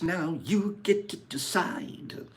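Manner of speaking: hushed, breathless whisper. Pretend. Whispering the choice to the viewers. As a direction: let it drop to a secret whisper. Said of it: "whispered repeat".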